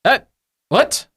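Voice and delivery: high, jumpy gnome professor; wary high-pitched shout through a door; flustered little voice calling back; startled professor calling out. Acted. Gnome professor calls from behind a door.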